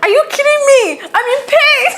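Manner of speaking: high, loud exasperated yell at husband; cursing in pain. Retold or imitated. Labouring wife telling off husband, high indignant yell. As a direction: re-enact an indignant outburst, climbing into a yell. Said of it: "I even cursed at him".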